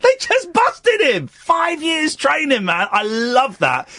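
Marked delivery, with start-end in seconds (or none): 0.0-2.7 high squeaky mocking voice, loud; shrill mock outrage; mocking squeal. Real, not acted. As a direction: squeal in disbelief at the muppet. Mocking the knife man, shrieking.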